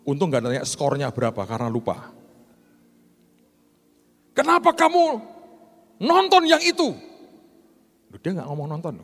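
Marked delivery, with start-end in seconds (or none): 4.3-6.9 much higher, louder interrogating officer voice; high questioning officer. Retold or imitated. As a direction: play the suspicious immigration officer, pitched way up. Pastor voices the visa officer. (suara petugas imigrasi yang curiga, jauh lebih tinggi)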